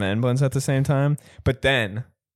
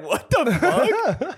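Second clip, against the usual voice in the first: high, drawn-out laughing exclamation; laughing, astonished squeal of a reply; mock disbelief; 12 semitones higher, much slower than usual. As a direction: retell it incredulous, stretched and pitched way up. Retelling stunned reply to coworker.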